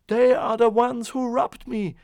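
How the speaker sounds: high, loud accusing shout. A narrator's reading. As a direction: yell it out, pointing at the thieves. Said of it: "the man shouted".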